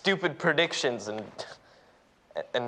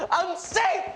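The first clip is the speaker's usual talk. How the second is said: high-pitched exclamation of survival; elated relief. Acted. Student realizing the danger has passed, elated. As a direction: burst out in giddy relief.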